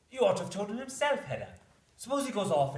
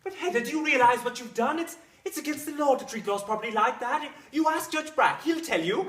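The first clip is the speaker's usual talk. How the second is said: high, loud, agitated reproach; appalled scolding; 6 semitones higher, 6 dB louder than usual. Acted. Husband warns wife she broke the law, appalled scolding. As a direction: berate her in shocked alarm.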